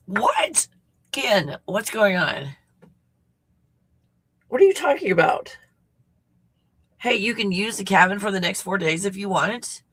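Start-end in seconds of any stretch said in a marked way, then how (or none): 0.1-0.7 sudden high startled "what"; surprised. Real, not acted. Reacting to the cabin offer. 4.5-5.6 high, loud disbelief; can't believe what you hear; surprised. Real, not acted. Reacting to the cabin offer.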